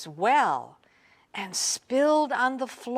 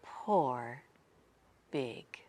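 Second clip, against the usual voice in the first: fading soft whisper; 7 semitones lower, 11 dB quieter than usual. A narrator's reading. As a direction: close the story gently. Soft story ending.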